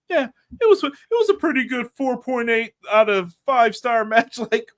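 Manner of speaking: high-pitched mock grudging praise, then incredulous; mocking disbelief. Host mimicking picky match raters, high mocking disbelief. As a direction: push it up high, exasperated at the nitpicking.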